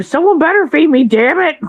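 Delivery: high, demanding cat voice; hungry pet voice. Retold or imitated. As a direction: voice the cat nagging for food. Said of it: "Joey's like".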